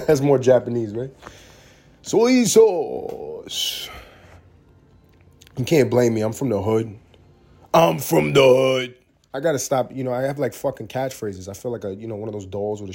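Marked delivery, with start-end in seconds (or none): 2.0-3.9 high, drawn-out mock Chinese accent. Comedian demonstrating a caricatured Chinese accent. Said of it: "I like doing Chinese accents". 7.7-8.9 slow, loud catchphrase; catchphrase call. Pretend. Comedian repeating his catchphrase, drawn-out and loud.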